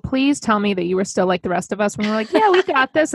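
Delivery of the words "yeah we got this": high, breezy mock confidence; mock overconfidence. Co-host mimicking parents before they had kids, mock-confident. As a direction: pitch it high, cocky and carefree.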